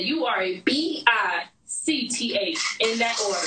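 slow spelling, letter by letter; quoted misspelled insult. Retold or imitated. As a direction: repeat the insult, spacing out B I C T H. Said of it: "this bitch spelled the word wrong".

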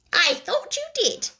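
high, pointed reply; tart mouse. A narrator's reading. The mouse answers the Lory, high and tart. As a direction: let the suspicion show.

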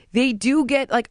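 ordinary talk

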